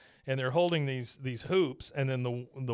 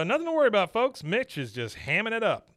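much higher, forced-cheerful showman voice; imitating a nervous announcer; 8 semitones higher than usual. Announcer reassures the crowd while the pig sinks, high and forced. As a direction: brush off the danger with fake cheer.

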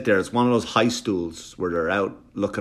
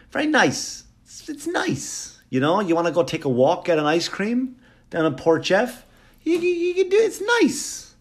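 high squeaky falsetto pitch; hammed-up town booster; 9 semitones higher than usual. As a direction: coax them along in a silly high voice.

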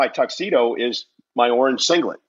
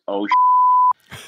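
shocked gasp; 3 dB louder than usual. Retold or imitated. Realises the match is real.

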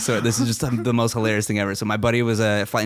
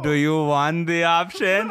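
mock tower voice; 7 semitones higher, slower than usual. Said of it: "And Tower was like".

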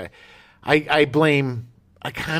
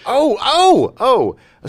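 surprised; 13 semitones higher, 9 dB louder, much slower than usual. Real, not acted. Reacts to the accent plan.

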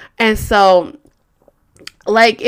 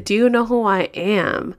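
mock haughty. Same line, haughty in queue.

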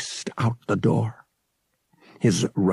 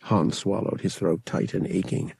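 plain reading voice

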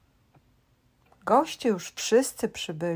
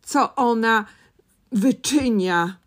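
brighter, louder storytelling; excited; 3 semitones higher, 5 dB louder than usual. A narrator's reading. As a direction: tell it with rising excitement. Excited narration of fly chaos.

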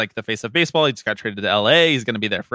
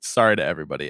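normal talking voice

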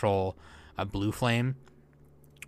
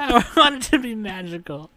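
high mocking echo; mocking whiny voice; 11 semitones higher, 4 dB louder than usual. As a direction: parrot him mockingly. Mocking friend's line.

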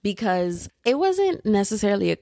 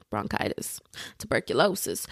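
dramatic mock-grave whisper; 6 semitones lower, 6 dB quieter than usual. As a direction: whisper it like a diagnosis. Joking after a cough.

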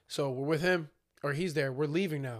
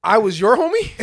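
higher and much louder, laughing mock outrage; 7 semitones higher, 12 dB louder than usual. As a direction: call him out loud in disbelief. Narrator mocking the guy, laughing.

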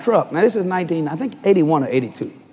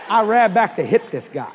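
ordinary talk

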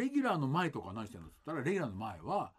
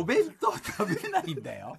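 louder, higher insistence on "dayo"; irritating "dayo"; 4 semitones higher, 6 dB louder than usual. Real, not acted. Stage actor insisting his co-star skips lunch, irritating "dayo". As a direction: push the would-be-cute "dayo" ending hard. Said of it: "ムカつきしか感じなかっただよ".

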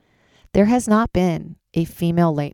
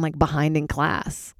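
ordinary talk, nothing marked

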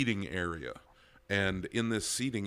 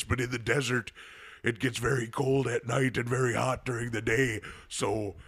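Jesse Ventura impression; 3 semitones higher, 5 dB louder than usual. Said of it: "went into Jesse Ventura".

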